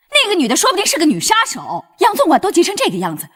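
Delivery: scolding. Acted. Mother scolds son for joking.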